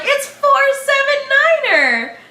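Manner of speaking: high squealed recognition, drawn out; excited high-pitched self-quote; excited exclamation. Retold or imitated. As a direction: exclaim it way up high, like a delighted fan. Acts out own excited recognition.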